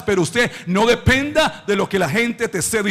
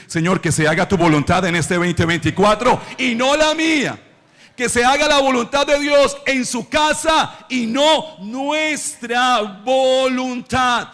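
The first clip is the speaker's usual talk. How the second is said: impassioned preaching; 3 semitones higher, 3 dB louder than usual. Real, not acted. Preacher urging the congregation to want God's will, impassioned. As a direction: preach it out with force.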